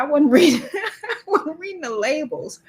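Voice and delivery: retold excuse broken by laughter; giggling through the retelling; laughing quote. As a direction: crack up while quoting the excuse. Laughs while quoting clerk's excuse.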